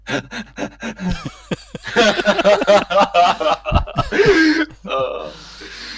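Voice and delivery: real laughter breaking out; long laugh. Real, not acted. Player laughing at his own melodrama, loud laughter. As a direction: laugh at your own hammy line.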